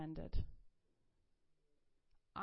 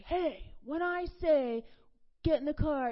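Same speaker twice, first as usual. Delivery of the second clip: raised, higher-pitched mom scolding; retold angry scolding; 6 semitones higher, 7 dB louder than usual. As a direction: scold a child loudly, acting it out. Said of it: "I was so mad".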